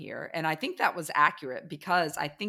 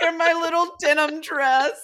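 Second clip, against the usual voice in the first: much higher, loud, babyish self-mocking; mock cutesy; 12 semitones higher, 9 dB louder than usual. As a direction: squeal it like a proud little kid. Echoing friend, mock cute.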